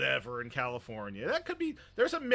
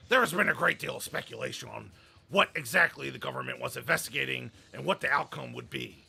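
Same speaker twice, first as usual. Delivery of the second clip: emphatic, blustering chairman's proclamation; Vince McMahon; 5 dB louder than usual. Acted. Host reading a statement about speculation, hammed-up boss impression. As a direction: hammer the key words like a showman boss. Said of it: "Vince McMahon voice that I can".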